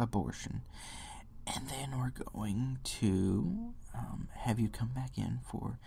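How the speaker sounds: whispering, soothing mock counselor; breathy whispered parody of a counselor. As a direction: hush it like a fake-caring clinic worker. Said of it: "they'll say".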